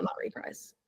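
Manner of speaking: a hushed self-correction; whispered correction. Real, not acted. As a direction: whisper it under your breath. Correcting herself.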